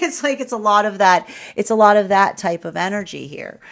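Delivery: ordinary delivery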